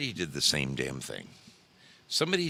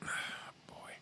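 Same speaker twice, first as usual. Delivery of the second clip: sighing whisper, breath more than voice; exasperated sigh; 12 dB quieter than usual. Real, not acted. Fed up with vandals, he sighs it under his breath. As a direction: let it out in a weary whispered sigh. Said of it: "I'm getting worked up".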